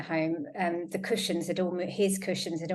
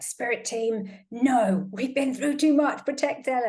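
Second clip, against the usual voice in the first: urgent calling out; 6 semitones higher, 4 dB louder than usual. Retold or imitated. Calling on spirits to protect dog.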